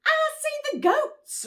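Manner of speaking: high squeaky little rabbit; small rabbit voice. Acted. Rabbit asking Mister Moose about dinner, high and squeaky. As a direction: pipe up like a tiny bunny.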